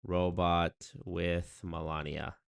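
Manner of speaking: Robot with Melania said very slowly; slow repeat. Shown as a demonstration. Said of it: "I did also not see".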